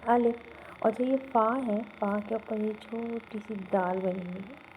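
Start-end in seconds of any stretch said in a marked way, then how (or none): 0.1-0.4 الف short and loud, not lengthened; said quickly. Shown as a demonstration. Teacher demonstrating the first letter, الف short and quick. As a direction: say الف briefly and firmly. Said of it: "جلدی پڑھنا".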